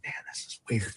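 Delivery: breathy under-the-breath whisper of unease; whispered to himself. Retold or imitated. Washing up in the bathroom, thinking aloud to himself, whispered. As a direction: murmur it so nobody nearby hears.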